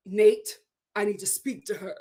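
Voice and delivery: low, stern imitation; mock-stern, pitch dropped, firm on the name. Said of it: "Real stern".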